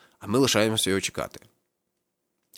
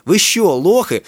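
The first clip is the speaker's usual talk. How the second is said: high, loud cocky jeer; 11 semitones higher, 11 dB louder, much slower than usual. A narrator's reading. Kakao feeling brave on his turf, loud cocky jeer. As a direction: puff up and mock them. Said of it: "хоробриться Какао".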